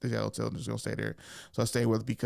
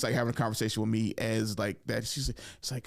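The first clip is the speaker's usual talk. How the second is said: ordinary talk, nothing marked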